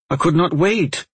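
high, urgent outburst; exclaiming. A narrator's reading. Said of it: "Aulin exclaimed".

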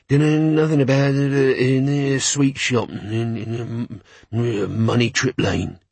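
halting, slowing creature voice; reluctant gnoll. A narrator's reading. Gnoll admitting the address to Carrot, halting creature voice. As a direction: give it up slowly, with ums.

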